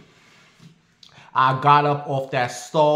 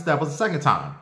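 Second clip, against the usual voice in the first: ordinary delivery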